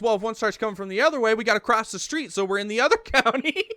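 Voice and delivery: loud, high retold reasoning, ending in laughter; amused mock explanation. Buddy explaining the county-line escape, retold loud and high into laughter. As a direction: explain it at high pitch, breaking up at the end.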